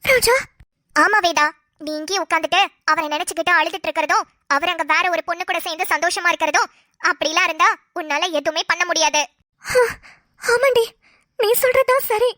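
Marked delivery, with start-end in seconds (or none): 1.0-9.2 lower, urgent friend pressing her advice; a lower-voiced scheming friend urging the crying wife; scheming friend Roja. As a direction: push her to go home now, low and insistent.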